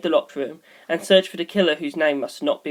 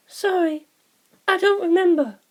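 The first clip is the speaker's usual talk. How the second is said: put-on much higher, light young girl voice; Sugimoto's voice; 12 semitones higher than usual. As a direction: lift into a bright girlish voice for her. Narrator voices Sugimoto's lines.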